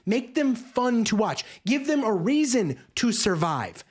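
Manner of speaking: ordinary delivery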